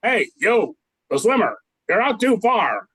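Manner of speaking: ordinary delivery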